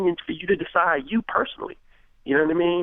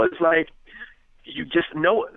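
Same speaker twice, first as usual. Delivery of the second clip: usual speaking voice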